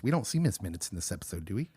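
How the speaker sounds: ordinary talk, nothing marked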